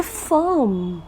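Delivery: फर्म with no r sounded; firm pronunciation. Shown as a demonstration. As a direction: say फर्म softly without rolling the r. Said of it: "ये जो word है F I आर M". (फर्म ऐसे बोलें)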